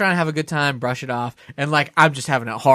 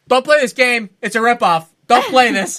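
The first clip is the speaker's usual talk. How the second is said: raised, high-pitched warning to a stranger; retold agitated warning; urgent warning; 7 semitones higher, 4 dB louder than usual. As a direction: call it out to warn them off. Warning off another customer.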